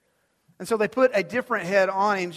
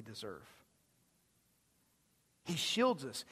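soft intimate preaching; 5 semitones lower, 9 dB quieter than usual. Acted. Preacher drawing the congregation close, softly and gently. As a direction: lean in and speak it gently.

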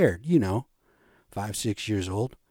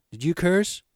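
questioning mother; 6 semitones higher than usual. Retold or imitated. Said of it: "So my ma says".

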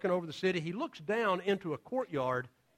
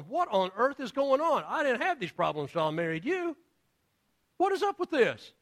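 higher, rising mock outrage; a king fed up with the plagues; exasperated Pharaoh; 6 semitones higher than usual. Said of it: "Pharaoh's going".

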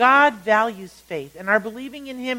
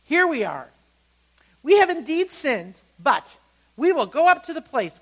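repentant people announcing themselves; 6 semitones higher, 5 dB louder than usual. Acted. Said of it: "they get up and they say this".

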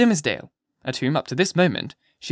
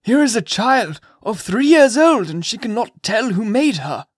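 put-on high, slow, scandalised old magistrate; a shocked Puritan official lecturing; stern old governor; 8 semitones higher, 5 dB louder, slower than usual. A narrator's reading. Governor to the gentlemen about Pearl, scandalised. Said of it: "cried the governor".

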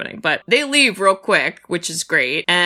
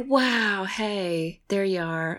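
soft, unbothered murmur; too relaxed for the moment; mock casual; 7 dB quieter, much slower than usual. Mocking the heroine's calm reaction, soft and casual. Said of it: "she was just like".

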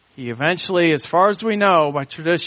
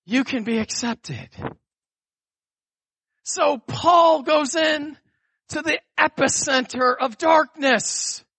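preaching climax; 8 semitones higher than usual. Acted. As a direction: cry it out to the crowd.

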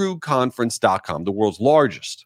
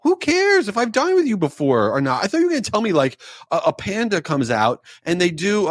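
high, baffled exasperated rant; incredulous rant; 5 semitones higher than usual. Retold or imitated. Complaining about the waiter's question, high incredulous rant. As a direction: rant like the question makes no sense. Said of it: "I'm like".

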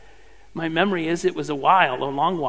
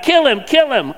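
higher, louder crowd yell; yelling mob; 8 semitones higher, 8 dB louder than usual. A narrator's reading. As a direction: shout it back like an angry mob. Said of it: "they yelled back".

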